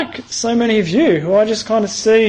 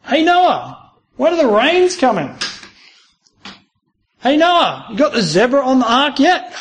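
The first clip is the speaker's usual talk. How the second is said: jeering neighbours, higher and taunting; mocking; 4 semitones higher than usual. Neighbours taunting Noah about the rain, higher sneering voice. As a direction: sneer each question at Noah. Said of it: "people mocking him".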